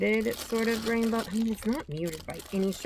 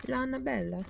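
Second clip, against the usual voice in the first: Lana Bella said with a hammed-up Italian accent, higher; mock Italian accent; 5 semitones higher than usual. Shown as a demonstration. Yarn vlogger hamming up the yarn name in a mock Italian accent. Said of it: "I always wanna say that with an Italian accent".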